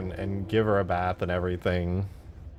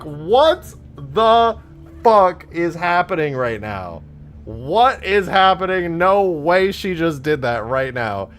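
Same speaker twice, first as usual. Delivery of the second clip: loud, high, drawn-out disbelief; flabbergasted; 7 semitones higher, 6 dB louder, slower than usual. Retold or imitated. Streamer retelling his cat's accident to viewers, flabbergasted. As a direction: stretch out each word in stunned, raised-voice shock. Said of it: "I'm also just, like, flabbergasted".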